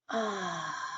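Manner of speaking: very soft, breathy release sigh. Shown as a demonstration. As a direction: let the breath out quietly.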